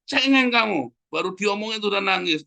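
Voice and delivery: high-pitched retold telling-off; mock scolding. Father to his crying child, mock scolding. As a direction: chide a crybaby, pitched well up. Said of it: "saya bilang".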